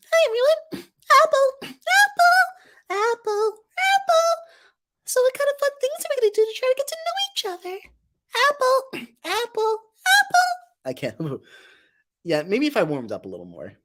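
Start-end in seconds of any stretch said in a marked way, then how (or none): 0.1-10.5 extremely high squeaky cartoon voice; Marshmallow. Streamer tapping into an old Marshmallow impression, tiny high squeak. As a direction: squeak way up in falsetto. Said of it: "a really good marshmallow as well".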